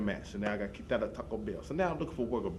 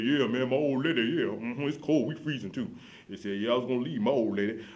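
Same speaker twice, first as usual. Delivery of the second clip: louder, put-on voice of a boastful hub regular; guy at the hub; 2 semitones higher, 5 dB louder than usual. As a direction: play the bragging older man at the bus stop.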